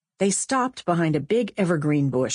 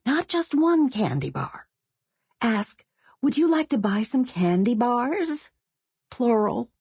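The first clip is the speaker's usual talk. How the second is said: put-on higher girl's voice, giving instructions; bossy girl Sonia; 7 semitones higher than usual. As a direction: coach her friend like a bossy eleven-year-old. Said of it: "Sonia said".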